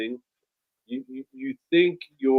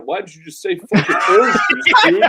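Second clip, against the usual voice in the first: laughing self-scold; 6 semitones higher, 9 dB louder, faster than usual. Retold or imitated. Scolding inner voice quoted.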